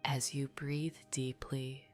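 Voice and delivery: plain reading voice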